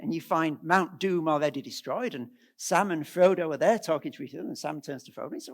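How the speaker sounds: usual speaking voice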